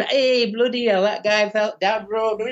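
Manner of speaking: broad Manchester joking voice; thick Manchester. Shown as a demonstration. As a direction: lay the Manchester accent on thick. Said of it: "absolute thick Manchester".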